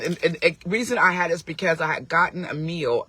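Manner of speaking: ordinary delivery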